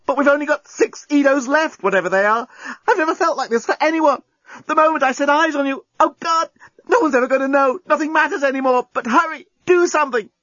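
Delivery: high, breathless-with-passion outpouring; frantic yelling. A narrator's reading. Lucy confessing as time runs out, yelling passionately. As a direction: urge a lover on frantically. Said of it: "yelled Lucy".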